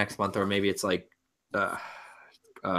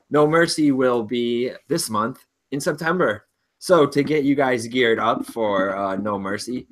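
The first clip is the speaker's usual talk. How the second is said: ordinary talk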